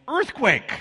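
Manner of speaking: high, loud alarmed yell; yelled warning. Retold or imitated. Wife yelling to husband about the quake, loud alarmed shout. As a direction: shout the alarm across the house. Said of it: "Lorianne yells".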